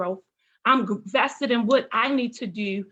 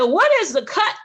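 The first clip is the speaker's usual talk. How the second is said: loud, high, bewildered question; raised voice in acted confusion; baffled; 7 semitones higher, 7 dB louder than usual. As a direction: demand to know what on earth that means. Acts out her baffled teenage question.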